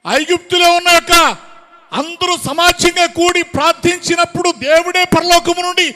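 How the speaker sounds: high, loud, pressing rhetorical questions; full-force preaching. Real, not acted. Preacher demands where their unity and love went, full-force preaching. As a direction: hurl each question at the congregation.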